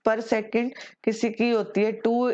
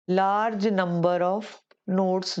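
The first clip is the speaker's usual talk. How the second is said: very slow, word by word; slow dictation; much slower than usual. Shown as a demonstration. Teacher dictating the phrase to students, very slowly. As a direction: spell it out for note-taking.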